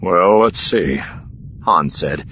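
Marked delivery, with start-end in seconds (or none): 0.0-1.0 musing, weighing it up; consideringly. A narrator's reading. Han answering when they will leave, consideringly. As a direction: think it over aloud.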